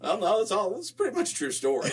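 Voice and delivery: laughing protest. Real, not acted. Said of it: "All of this is made up".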